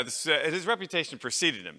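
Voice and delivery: normal talking voice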